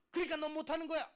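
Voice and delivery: high, shouted verdict; acted loud telling-off; scolding teacher. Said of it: "라고 얘기를 자꾸 하는 거예요".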